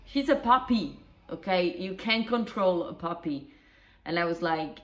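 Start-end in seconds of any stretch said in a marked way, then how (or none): none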